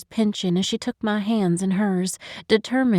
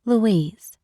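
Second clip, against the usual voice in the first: plain reading voice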